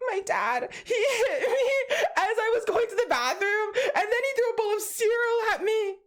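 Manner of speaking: high, tearful telling; a crying boy telling his teacher what dad did; teary kid's report. Retold or imitated. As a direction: blurt it out through tears.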